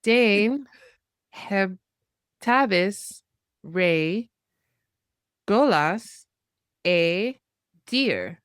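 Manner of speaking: slow, separated Cornish syllables; staccato. Shown as a demonstration. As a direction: piece the phrase together word by word. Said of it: "then we will put it all together".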